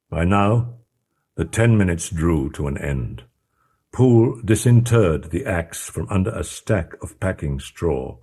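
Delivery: ordinary delivery, nothing marked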